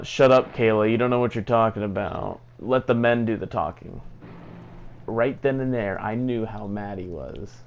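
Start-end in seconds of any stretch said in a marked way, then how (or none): 0.0-4.0 louder mock yell at Kayla; imitating an angry shout. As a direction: bark it like the furious boyfriend. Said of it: "pretty much he was like".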